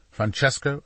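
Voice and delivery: ordinary delivery, nothing marked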